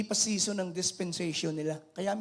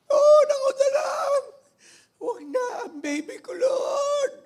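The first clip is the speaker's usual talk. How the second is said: much higher, louder wailing plea; crying shout; 10 dB louder than usual. Acted. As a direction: beg Jesus to take her instead of the baby. Mother begging to take the illness. (sumisigaw na pagsusumamo)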